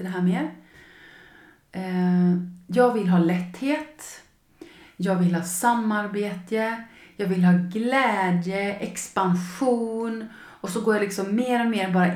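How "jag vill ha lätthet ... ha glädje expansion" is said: slow intoned wishes. Shown as a demonstration.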